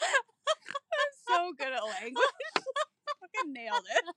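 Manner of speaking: slow laughing sarcasm; sarcastic. Real, not acted. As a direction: drawl it through laughter.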